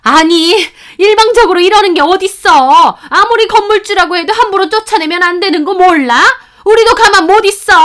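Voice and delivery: very high, loud desperate outcry; panicked protest. Acted. Tenants facing eviction, high loud panicked protest. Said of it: "사색이 돼서 따지더라고요".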